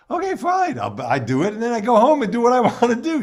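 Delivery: tossed off fast and breezy, as if it costs nothing; flippant mock convert. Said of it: "why they didn't just say".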